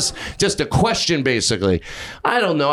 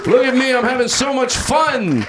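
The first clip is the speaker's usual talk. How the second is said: high, hammed-up glee; put-on whooping enthusiasm; mock excitement; 7 semitones higher than usual. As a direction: show off for the promo camera, high-pitched. Hamming it up for promo camera.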